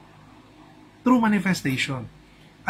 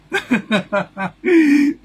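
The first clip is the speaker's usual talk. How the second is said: big high laugh bursting out; delighted loud laughing fit; loud burst of laughter; 11 semitones higher, 8 dB louder than usual. Real, not acted. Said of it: "Meron ba?".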